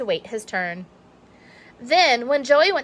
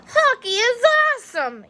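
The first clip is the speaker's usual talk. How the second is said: roared; 14 semitones higher than usual. Acted. Young shark roaring at hockey game. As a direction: bellow it out in triumph. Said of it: "he roared".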